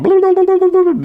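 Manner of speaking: high and loud, blue blue blue; excited blue blinking. Retold or imitated. Recalling the ship lights going blue, excited and high.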